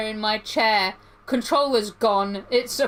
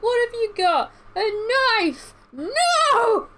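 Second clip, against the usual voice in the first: much higher, shrieking mom voice; panicked meme mom; 12 semitones higher, 4 dB louder, much slower than usual. Retold or imitated. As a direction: squeal it like the frantic meme mom.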